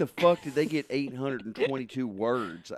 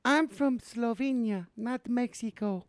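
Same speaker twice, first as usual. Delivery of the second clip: high, flat Slovenian wife; a high deadpan correction; Melania voice; 11 semitones higher than usual. Acted. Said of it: "Let me do her".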